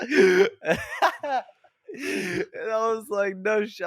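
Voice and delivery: laughing too hard to say it plainly; real giggling. Real, not acted. Laughing through own retold reply.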